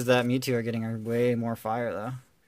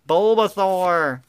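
stretched out and louder, announcing the pull; excited call-out; 5 semitones higher, 5 dB louder, slower than usual. Real, not acted. Opening a pack on camera, drawn-out excited call. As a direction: call the name out long and loud. Calls out the card she pulled.

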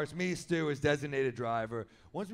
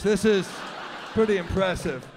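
much louder, impressed burst on "pretty impressive"; amazed; 5 semitones higher, 9 dB louder than usual. Retold or imitated. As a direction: blurt "This is pretty impressive" in loud awe. Rising amazement at Stu.

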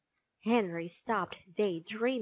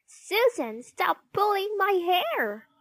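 very high, loud complaint; squealing; 11 semitones higher, 5 dB louder than usual. A narrator's reading. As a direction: squeal at a classmate pulling hair. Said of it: "squealed Vain Violet".

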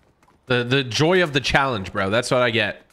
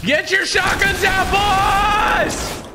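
high, loud battle cry; rally the squad, slow and loud; rallying shout; 4 dB louder, much slower than usual. Real, not acted. Rallying teammates in a fight.

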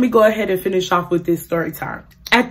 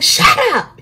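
high-pitched mock screech; acted-out high yelp; retold scream. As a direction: imitate the officer screaming, pitched way up. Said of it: "He starts screaming".

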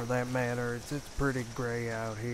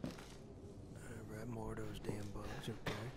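soft sneaking whisper; 12 dB quieter, slower than usual. Real, not acted. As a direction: breathe it out so nobody hears. Sneaking through a crack den.

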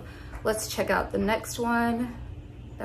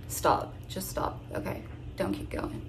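hushed; 3 semitones lower, 8 dB quieter than usual. Real, not acted. Advising rest after exhausting workout.

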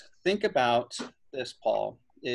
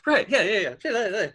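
laughing; 8 semitones higher, faster than usual. Real, not acted. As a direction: laugh through the words. Laughing at Katie's recording remark.

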